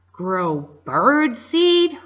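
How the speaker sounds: slow, loud disbelieving outrage; pirate captain furious at being made a farmer; outraged. Acted. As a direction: spit each word out in anger. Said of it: "turned pale with anger".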